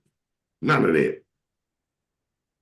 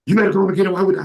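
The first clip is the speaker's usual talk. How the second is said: much higher and louder, pushing back hard; loud, high retort; 13 semitones higher, 7 dB louder, much faster than usual. Retold or imitated. Son shouting back at his mom, high and loud.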